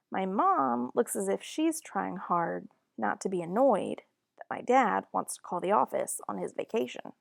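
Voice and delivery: usual speaking voice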